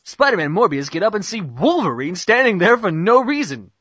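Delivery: ordinary talk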